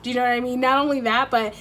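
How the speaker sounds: usual speaking voice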